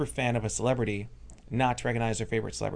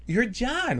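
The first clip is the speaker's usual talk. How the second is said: excited recognition; 12 semitones higher than usual. Retold or imitated. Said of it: "She's like".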